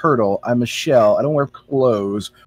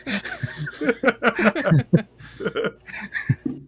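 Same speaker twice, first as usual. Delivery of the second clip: high breathless fit of laughter; long helpless laugh going up high; 13 semitones higher, 5 dB quieter than usual. Real, not acted. Player cracks up at own joke.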